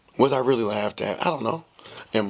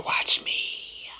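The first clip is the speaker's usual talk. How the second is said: soft menacing whisper; whispered dare; 4 dB quieter than usual. Retold or imitated. Answering his doubting friend with a whispered dare. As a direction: breathe it out as a dare.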